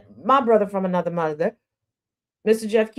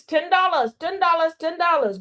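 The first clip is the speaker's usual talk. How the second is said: ten dollars repeated higher, each falling; emphatic price repeat; 7 semitones higher than usual. Acted. Host pushing the ad price to viewers, repeating it higher. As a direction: hammer home ten dollars three times.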